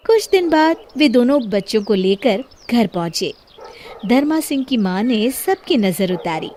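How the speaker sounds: plain reading voice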